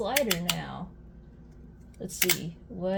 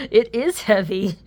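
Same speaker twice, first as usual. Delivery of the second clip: laughing amazement; 2 semitones higher, 9 dB louder than usual. Real, not acted. Laughing at the weight.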